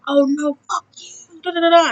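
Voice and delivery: mock outburst. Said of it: "It's like".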